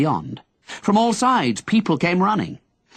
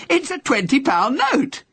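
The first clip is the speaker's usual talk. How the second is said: excited yell; 12 semitones higher than usual. Acted. Young man shouting up to Henry, excited yell. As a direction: yell it up while jumping with joy. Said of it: "the man yelled".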